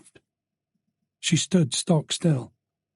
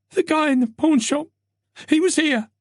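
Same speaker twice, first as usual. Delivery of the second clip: put-on high, urgent young woman's voice; scared detective reporting; frightened Tess; 14 semitones higher than usual. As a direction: blurt it out, shaken.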